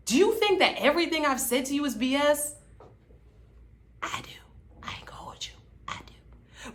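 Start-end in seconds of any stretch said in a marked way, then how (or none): 4.0-6.7 hushed confiding whisper. Real, not acted. Vlogger whispering an aside to viewers. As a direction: lean in and whisper it. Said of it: "aside to viewers".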